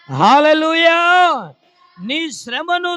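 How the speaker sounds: high, drawn-out pulpit shout; full-voiced revival call, very loud; shouted praise. Real, not acted. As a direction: cry out to rouse the congregation. Calls congregation to respond.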